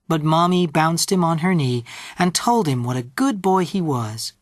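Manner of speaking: ordinary delivery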